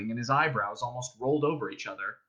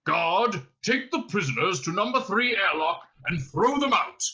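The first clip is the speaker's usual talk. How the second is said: commanding captain's order, slightly raised; barked order; 6 semitones higher, 3 dB louder than usual. A narrator's reading. Captain ordering his guard to eject prisoners, commanding. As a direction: order it out with authority.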